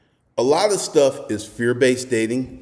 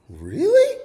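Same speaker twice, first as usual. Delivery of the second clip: high imitated woman's question; woman's surprised reply. Retelling the blonde answering his bold line, high mock surprise. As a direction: voice her stunned disbelief, higher.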